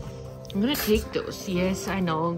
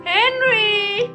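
high, loud, sustained call; calling the dog; 11 semitones higher, 8 dB louder than usual. Real, not acted. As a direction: sing out the name to fetch the dog.

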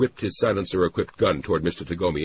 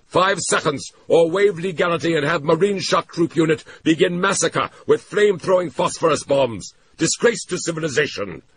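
loud, much higher furious tirade; shouting; 13 semitones higher, 6 dB louder than usual. A narrator's reading. Tagomi screams threats at the SD office, hysterical. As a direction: rant and scream invective. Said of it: "Mister Tagomi shouted".

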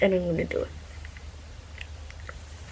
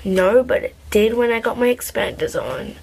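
ordinary talk, nothing marked

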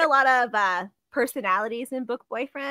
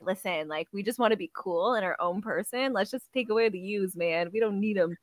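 low, soft, laid-back cool talk; would-be cool eccentric; 4 semitones lower, 7 dB quieter than usual. Retold or imitated. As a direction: play it too cool to care, quietly. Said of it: "like, eccentric. They're like".

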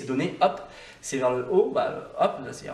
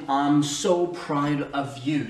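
slow, careful "I'm so proud of you."; slow demo; 4 dB louder, much slower than usual. Shown as a demonstration. Teacher modeling the phrase slowly for learners. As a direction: stretch out each word of "I'm so proud of you.". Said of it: "je vais te les dire".

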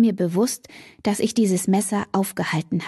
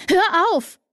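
high sharp shout to break up a fight; shouted stop; 11 semitones higher than usual. Acted. Woman shouting at two men fighting to stop. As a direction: yell it to stop them cold.

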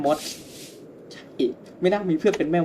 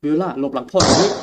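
louder, urgent command to hide; urgent father's order; 3 semitones higher, 8 dB louder than usual. Acted. Father shielding his daughter, urgent loud command. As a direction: snap the order out like a scared dad.

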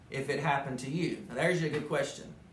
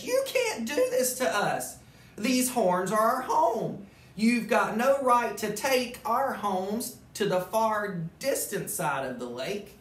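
indignant guest; 8 semitones higher, 5 dB louder, slower than usual. Acted. Guests protest the moose leaving.

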